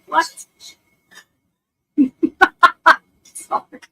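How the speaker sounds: normal talking voice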